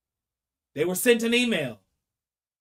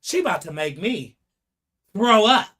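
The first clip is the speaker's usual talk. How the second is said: disgust; 4 semitones lower than usual. Pretend. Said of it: "here comes disgust".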